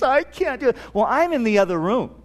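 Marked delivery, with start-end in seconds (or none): 0.0-0.8 imitating crying child. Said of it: "and she's crying".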